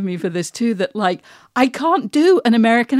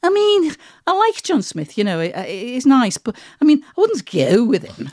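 higher-pitched Scouse lilt; Scouse accent; 3 semitones higher than usual. Acted. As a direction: play the friend from Liverpool in a Scouse accent. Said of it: "from that part of the world".

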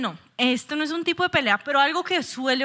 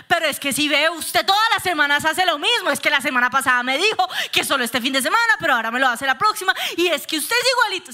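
higher-pitched, insistent nagging; acted nagging argument; 4 semitones higher than usual. Wife laying out her arguments to her husband, acted nagging. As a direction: press the complaint without a pause. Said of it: "yo decía".

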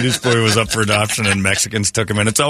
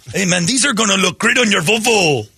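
mock Swedish accent; 7 semitones higher than usual. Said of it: "why do I feel like you're doing an accent".

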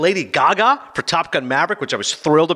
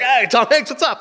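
high-pitched excited greeting; star-struck fan; 8 semitones higher than usual. Acted. Host imagining meeting a movie star, star-struck greeting. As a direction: call out to your idol, pitched up.

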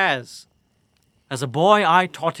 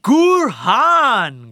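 proud shout; 6 semitones higher, 6 dB louder than usual. Acted. Prisoner proudly announces his title.

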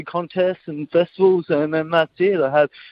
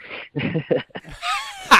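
high laugh. Real, not acted. Guest laughs at joke.

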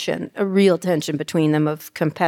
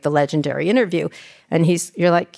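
ordinary delivery

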